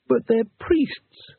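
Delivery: high, slow, wailing protest; wailed. A narrator's reading. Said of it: "wailed Two Flower".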